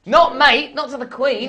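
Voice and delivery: loud, high mock-indignant correction; mock outrage. Correcting the interviewer's British line, loud mock outrage. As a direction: scold her in hammed-up horror. Scolding her for saying mate.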